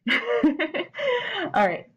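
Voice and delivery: a laugh breaking into the words; self-conscious laugh after an itchy nose. Real, not acted. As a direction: laugh it off, then carry on. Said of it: "I don't know why my nose is itchy".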